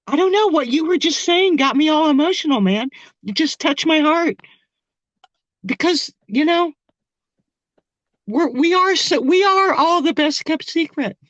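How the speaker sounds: moved close to tears; choked up with emotion; emotional. Real, not acted. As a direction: voice lifting with held-back tears. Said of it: "I don't know why I'm getting emotional".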